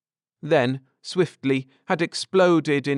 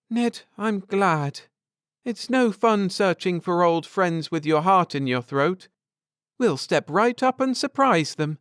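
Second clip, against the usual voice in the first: higher, eager voice; bubbling with anticipation; eager wife; 7 semitones higher than usual. A narrator's reading. Wife eager to surprise old friends, higher voice.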